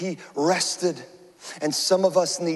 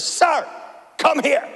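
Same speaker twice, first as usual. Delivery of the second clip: stern TSA officer; 10 semitones higher, slower than usual. Retold or imitated. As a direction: summon him like an intense security agent. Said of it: "and she says".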